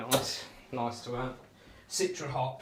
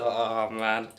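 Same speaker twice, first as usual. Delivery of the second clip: ordinary talk, nothing marked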